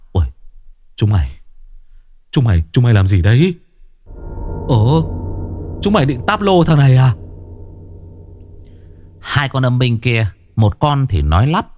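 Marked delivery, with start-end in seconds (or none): 0.1-7.1 quick alarmed outburst; startled. A narrator's reading. Chí Béo startled at his ghost soldiers, quick alarm. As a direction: catch them by surprise, fast. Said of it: "Chí Béo trợn mắt".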